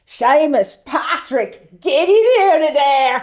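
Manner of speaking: put-on high, loud Dublin child calling in; Dublin kid voice. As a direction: shout them in like a Dublin kid. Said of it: "And my one line was".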